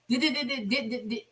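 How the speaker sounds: imitating a quiet stuck stutter on D-d-d-d-d-d-d-d-d. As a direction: softly repeat the D sound, stuck. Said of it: "he stuttered so bad".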